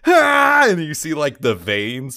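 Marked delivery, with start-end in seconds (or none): 0.1-0.7 loud high power-up yell; power-up scream. Pretend. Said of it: "somebody's just like".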